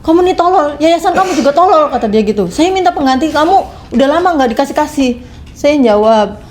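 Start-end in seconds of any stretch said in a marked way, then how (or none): 0.0-1.9 fast, higher-pitched imitated rebuke; retold angry scolding. Employer scolding the maid for reporting to the agency, mock angry. 2.5-5.2 raised-pitch imitation of the boss; retold angry complaint. Employer demanding a replacement maid, imitated with raised pitch.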